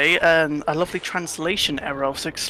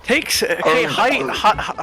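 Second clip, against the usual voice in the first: ordinary talk, nothing marked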